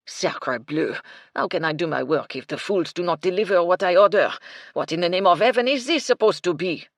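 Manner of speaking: put-on furious French chef, loud French accent; strong French accent. A narrator's reading. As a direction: rage in a thick French accent. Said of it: "a deep voice with a strong French accent".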